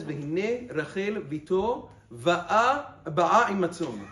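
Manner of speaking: slow Torah verse recital; slow verse reading. Shown as a demonstration. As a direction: read the pasuk slowly, like from the scroll. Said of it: "it says".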